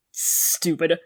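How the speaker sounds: ordinary talk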